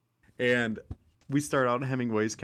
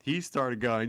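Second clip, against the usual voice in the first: ordinary talk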